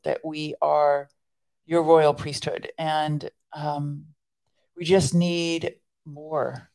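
earnest, measured prayer voice. Acted. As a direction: pray it sincerely and steadily. Leads a closing prayer.

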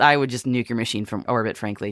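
usual speaking voice

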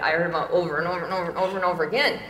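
rapid-fire listing of over and over; rattled off fast. Real, not acted. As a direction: rush through the repeats, running them together. Rattling off endless repetition.

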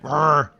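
loud rolled creature trill; improvised beast call; bird creature noise. Acted. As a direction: make a loud "brr" like a strange big bird. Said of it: "or whatever Beak Beak sounds like".

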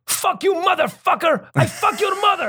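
high, drawn-out cursing; angry caller outburst. Retold or imitated. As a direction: imitate an enraged phone scammer yelling. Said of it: "they've got a thick accent".

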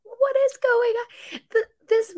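high, slow, disbelieving; mock astonished at the screen; baffled. Retelling her reaction to the donuts, high and baffled. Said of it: "I was like".

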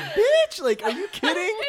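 squealing with laughter in disbelief; laughing disbelief. Real, not acted. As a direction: cry out in amused outrage. Reacting to her line, laughing.

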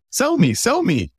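high, pleading mock customer; mock customer begging. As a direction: play the eager customer begging to be sold to. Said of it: "They're saying".

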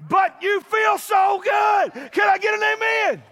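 shouted, high and loud preaching; preaching at full force. Acted. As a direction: lift the church, full voice.

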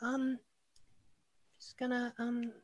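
put-on high, timid, hesitant child; little girl voice. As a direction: stammer like a shy caught-out kid. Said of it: "a small girl".

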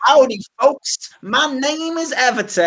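slow, whooping cartoon American host; mock American accent. Co-host impersonates American colleague, hammed-up American accent. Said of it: "I could do your voice".

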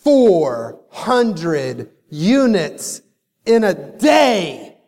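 four hundred units stretched out slowly; proud boast of four hundred units, dragged out slowly; slow emphatic brag. Retold or imitated. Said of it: "I said".

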